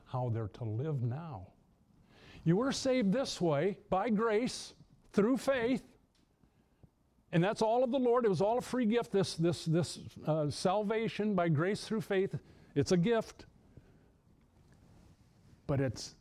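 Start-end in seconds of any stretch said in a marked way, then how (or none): 2.4-13.5 rising preacher fervor. Real, not acted.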